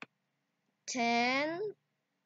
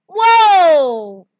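loud, drawn-out whoa of delight; excited scream; 3 semitones higher, 12 dB louder than usual. Real, not acted. Maker screaming at her finished effect, loud and thrilled. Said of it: "I'm screaming".

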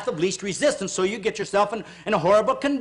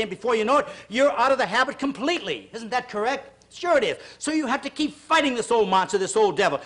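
ordinary delivery

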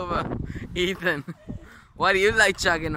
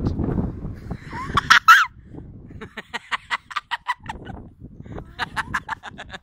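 laughing. Real, not acted. Laughing at joke.

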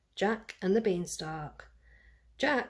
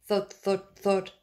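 plain reading voice

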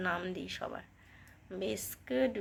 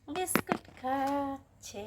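drawn-out cooing; 4 semitones higher, 4 dB quieter, much slower than usual. Real, not acted. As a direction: stretch every syllable like doting on a pet. Cooing at the rabbit eating.

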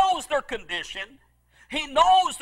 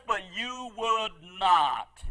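slow and low, a touch of grief; slow sorrowful lament; 6 semitones lower, 5 dB quieter, slower than usual. Acted. Jesus weeping over Jerusalem, slow low sorrow. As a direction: let it fall slow and low like a sigh. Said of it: "He's weeping now".

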